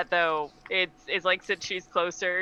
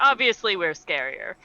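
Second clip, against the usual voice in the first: smug laughing; 7 semitones higher, 3 dB louder than usual. Acted. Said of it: "It's just like".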